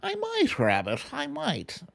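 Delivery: squeaky high "I might", then back down for "Rabbit"; coy high-pitched maybe; playful high tease. Real, not acted. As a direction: tease the viewer in a high sing-song. Teasing answer to viewer.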